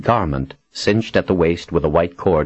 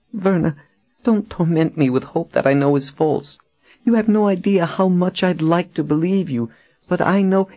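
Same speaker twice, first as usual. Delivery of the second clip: very high-pitched, anguished woman's voice; frightened, despairing captive woman; 13 semitones higher than usual. Acted. Captive begs her friend not to offer false hope, anguished. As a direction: plead high, afraid to hope.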